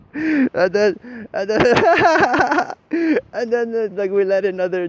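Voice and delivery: high, loud, words broken by laughter; can barely get the words out for laughing; laughing through words. Real, not acted. Telling listeners about demons, laughing through it. Laughing while trying to continue.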